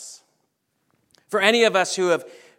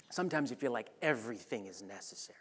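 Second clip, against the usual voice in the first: softer, hushed confession; a preacher's whispered admission to the congregation; 8 semitones lower, 14 dB quieter, faster than usual. Real, not acted. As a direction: let it drop to a breathy secret. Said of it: "whispered aside".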